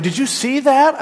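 wife startled by something crossing the road at night; startled question. Retold or imitated. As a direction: sound suddenly spooked at the wheel. Wife startled while driving.

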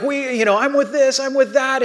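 high-pitched mock squabble; imitated bickering shouts. Kids shouting back and forth, mock shouting. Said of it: "and they're shouting".

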